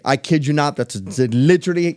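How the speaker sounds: usual speaking voice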